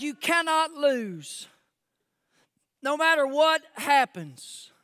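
high, loud, emphatic preaching; full-force preaching. Real, not acted. Preacher proclaiming God's promise to Joshua, full-force preaching. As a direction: proclaim it loudly to the room.

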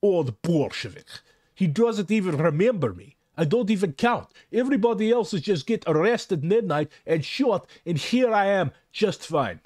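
hammed-up, heavy Russian accent; horrible Russian accent. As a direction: do a deliberately bad Russian accent. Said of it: "put on my horrible Russian accent".

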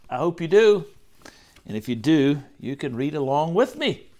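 usual speaking voice

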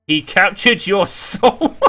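high, helpless laughter through the words; shriek-laugh at the jump scare; laughing in fright. Real, not acted. Gamer caught by a jump scare, laughing high. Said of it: "I'm crying out of fear".

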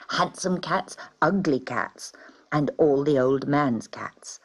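ordinary delivery

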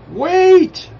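very high, loud urgent shout; yelling. A narrator's reading. Druid yelling at the charging warrior to stop. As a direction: yell to stop someone mid-charge. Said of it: "the druid who was yelling at him".

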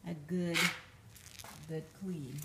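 ordinary talk, nothing marked

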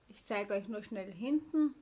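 ordinary talk, nothing marked

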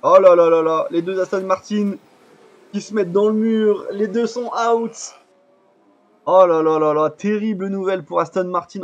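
dismayed exclamation. Real, not acted. Both Aston Martins crash out.

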